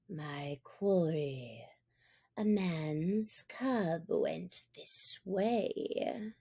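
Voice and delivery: low, slow and soft tiger growl; a lurking, threatening tiger; menacing tiger. A narrator's reading. Shere Khan demands the cub at the cave, low and slow.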